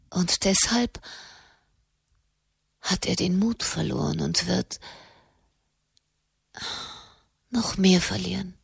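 halting, half-whispered murmur. A narrator's reading. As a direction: murmur it softly with long pauses, as in a trance. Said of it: "murmelte sie".

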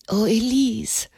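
mild lament, a hint of wailing; wailed. A narrator's reading. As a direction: plead with her, lightly grieving. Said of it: "jammerte Blanchefleur". (leicht jammernd)

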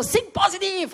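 high, shouted mock pep-talk; jeering motivational shout; friend's pushy cheer. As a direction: yell it like an overeager buddy poking your belly. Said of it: "er war so".

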